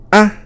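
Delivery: sung on one high pitch; a high-tone "Ah!" held up. Shown as a demonstration. Teacher demonstrating a high tone to learners. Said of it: "This is a high tone".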